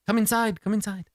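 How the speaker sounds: eager invitation. Acted. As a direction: usher them in eagerly. Doctor hustles them in.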